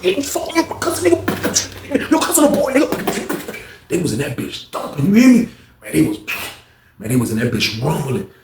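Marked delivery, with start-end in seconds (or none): 0.0-3.6 very high strained yells; mock brawl yelling. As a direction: yell like mid-fight. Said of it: "all you heard was".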